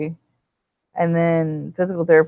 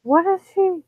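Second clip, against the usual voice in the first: confused surprise; 13 semitones higher, 5 dB louder than usual. Retold or imitated. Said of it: "I was like".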